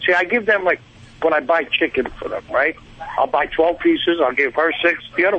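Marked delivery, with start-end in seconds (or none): none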